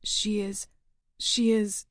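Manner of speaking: hollow, strange-sounding voice; a stunned boy, voice gone strange; a hint of an unfamiliar voice; alien voice. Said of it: "Gordy speaks in an alien voice".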